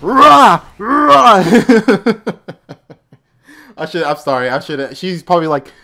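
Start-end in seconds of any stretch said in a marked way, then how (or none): 0.0-1.4 loud, high mock fighter's yell; mocking battle cry. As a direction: imitate her battle shout, exaggerated. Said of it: "made fun of my voice".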